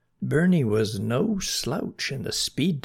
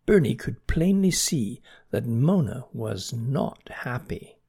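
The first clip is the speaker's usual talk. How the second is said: ordinary delivery, nothing marked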